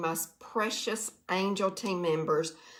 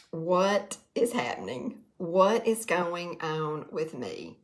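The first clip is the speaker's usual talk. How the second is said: ordinary delivery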